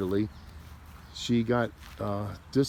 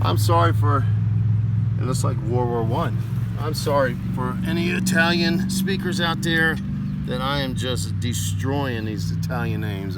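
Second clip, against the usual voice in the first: loud, higher-pitched apology to listeners; calling out apology; 4 semitones higher, 8 dB louder than usual. Real, not acted. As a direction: call out the apology loudly, pitched up. Apologising aloud to Italian speakers out there.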